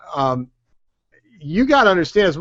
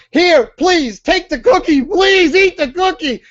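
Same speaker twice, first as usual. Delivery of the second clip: high, pleading and hammed-up; mock begging; 13 semitones higher, 4 dB louder than usual. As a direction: beg them to take it, far too eager. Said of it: "instead of going".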